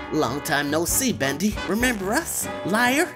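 loud, menacing gang thug. Acted. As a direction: sneer it like a tough gangster. Voicing Butcher Gang villain.

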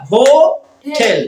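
slow, loud "hotel", paused between হো and টেল; split into syllables. Shown as a demonstration. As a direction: stretch "hotel" into two clear beats. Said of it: "কয়টা syllable হলো?".

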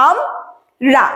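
আম and রা split by a clear pause; আম রা broken into its two syllables. Shown as a demonstration. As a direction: say আম, pause, then রা. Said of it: "এই যে দুইবার উচ্চারণ করলাম আমি অক্ষরটা".

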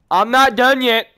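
high, loud protest; defiant shout. Real, not acted. Defiant shout during game.

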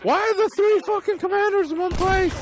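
high, loud scolding yell; incredulous, high-pitched outburst; exasperated shout. Real, not acted. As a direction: berate the teammates at a high pitch. Berating teammates during the game.

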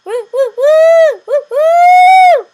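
high loud hooting woo sounds; walrus noise. Retold or imitated. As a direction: hoot like a walrus. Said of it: "walrus noise".

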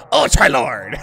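loud cartoon cry; Sheen. Gamer showing off an impression of Sheen, loud cartoon shout. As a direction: shout it as an excitable cartoon character. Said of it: "wanna hear my Sheen impression?".

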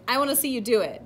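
can't wait to watch; excited. A narrator's reading. Pollywog eager to watch the caterpillar, excited. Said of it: "the pollywog said excitedly".

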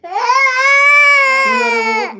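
long high stretched cry over the word; wailing it out long and high; drawn-out wail. Real, not acted. Parent scolding a crying toddler, long high wail.

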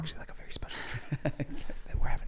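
laughing hushed whisper; giggly prank whisper. Pretend.

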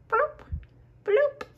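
very high squeaky bloup, bloup; high copied bubbles. Acted. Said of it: "Et le pingouin l'imita".

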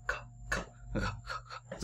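"k" chỉ có hơi, không có giọng; bật "k" nhẹ bằng hơi; thì thầm âm k. Shown as a demonstration. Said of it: "tiếp theo là k". (thì thầm âm "k" bật hơi)

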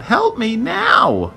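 high, drawn-out desperate cry; slow, squeaky demand for help; screamed plea. A narrator's reading. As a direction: stretch it into a tiny-voiced scream. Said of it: "the voice screamed out".

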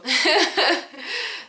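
short, high-pitched giggle; high little self-conscious giggle. Real, not acted. Owning up to awkward chats, giggling. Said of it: "so I just giggle".

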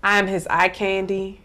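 usual speaking voice

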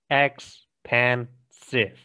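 expansive stretched out slowly, syllable by syllable; syllables drawn out. Shown as a demonstration. Teacher counting the syllables in expansive, slow and clear. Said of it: "Ok, tres".

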